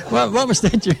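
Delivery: high, laughing mock question; laughing while quoting. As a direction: squeeze the words out through laughter. Teller laughs quoting the flustered man.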